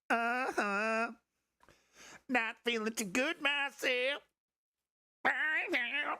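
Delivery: high, slow, whiny put-on voice; grating mock voice for the chat; obnoxious voice. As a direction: whine it out to be as annoying as possible. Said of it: "is that obnoxious enough for you".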